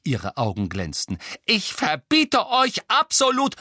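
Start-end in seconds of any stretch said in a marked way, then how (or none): 1.4-3.5 loud, high, drawn-out scolding; angry prohibition. A narrator's reading. Mother forbidding her children to stay, angry raised voice. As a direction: forbid it outright, voice raised and slow.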